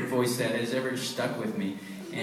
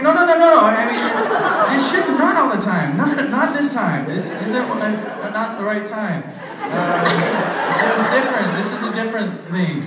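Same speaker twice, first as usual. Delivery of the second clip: flustered apology; 8 semitones higher, 7 dB louder than usual. Retold or imitated. Panicked apology retold.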